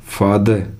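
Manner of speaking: "फादर" said as "faa-duh", no r; silent r. Shown as a demonstration. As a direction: end "फादर" on an open schwa. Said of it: "आर का उच्चारण नहीं होता".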